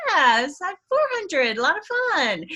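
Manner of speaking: cheerfully hide the bad number; forced positivity. Saleswoman faking cheer to a teammate, mock bright. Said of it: "just trying to keep that positive attitude".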